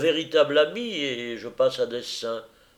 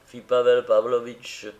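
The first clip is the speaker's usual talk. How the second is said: plain reading voice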